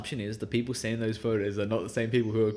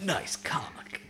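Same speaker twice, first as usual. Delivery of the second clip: whispered mock Shadow the Hedgehog line; breathy edgy hedgehog voice, whispered; Shadow the Hedgehog impression; 3 semitones higher, 9 dB quieter than usual. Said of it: "Is that your Shadow the Hedgehog impersonation?".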